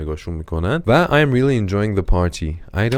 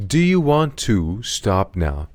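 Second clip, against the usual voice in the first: slow repeat; slower than usual. Shown as a demonstration.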